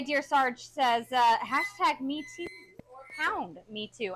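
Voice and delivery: usual speaking voice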